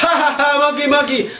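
high, mock laugh at a monkey; acted laughing. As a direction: laugh the words out, high and playful. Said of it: "so I laughed".

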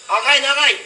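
high, loud shouted reply; calling out. Retold or imitated. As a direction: bark it out over the bus noise. (mataas at malakas na sigaw)

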